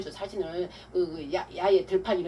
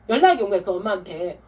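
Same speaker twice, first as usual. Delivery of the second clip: ordinary delivery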